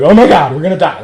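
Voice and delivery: loud, hammed-up panic; mock freak-out. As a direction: imitate people panicking over nothing. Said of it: "They're freaking out".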